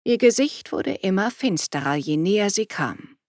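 plain reading voice

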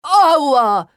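high, loud, drawn-out cry of pain; hurt man's outcry; pained yell. Acted. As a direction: yelp as if a sore spot was touched. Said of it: "schrie er".

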